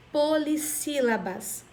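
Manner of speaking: slow "polissílabas" as poli, sílabas; syllable emphasis. Shown as a demonstration. Teacher naming a class of words, "polissílabas" said slowly in parts. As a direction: stretch both parts of "polissílabas". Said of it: "quatro sílabas ou mais".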